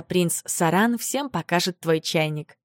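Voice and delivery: plain reading voice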